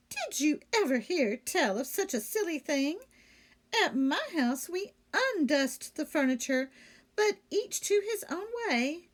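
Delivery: pleased new maid admiring her employers; Amelia Bedelia voiced. A narrator's reading. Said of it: "Amelia comments on list".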